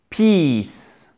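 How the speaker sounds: PEACE with a long, falling "ee". Shown as a demonstration. Teacher reviewing the long sound, drawn-out i. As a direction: stretch Peace out fully. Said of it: "son long".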